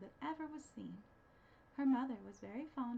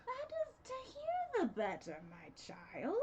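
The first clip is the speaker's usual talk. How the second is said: sly wolf; 11 semitones higher, slower than usual. Acted. Wolf answering.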